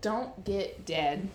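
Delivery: mock Dean. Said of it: "And Dean's like".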